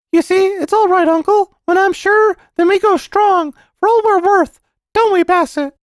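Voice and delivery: put-on very high, loud, earnest child; confident little boy. Boy explaining his system to uncle, high and confident. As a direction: boast like a sure small boy.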